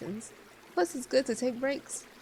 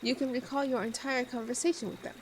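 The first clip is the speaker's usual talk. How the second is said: normal talking voice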